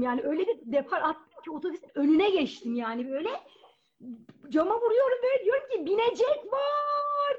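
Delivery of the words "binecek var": uzatarak, tiz ve yüksek bir çığlık; otobüs şoförüne yetişmek için haykır; bağırarak. Retold or imitated. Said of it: "diye bir bağırdım ben". (kaçan otobüse uzatarak bağırış)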